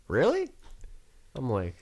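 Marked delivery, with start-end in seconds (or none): 0.1-0.5 much higher rising really; mock drunk surprise. Drunk friend is told they spent the night together, squeaky surprise. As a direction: squeak it in disbelief.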